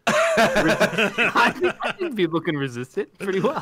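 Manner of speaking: chuckling through the words; laughing. Real, not acted. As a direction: laugh while saying it. Laughing at own story.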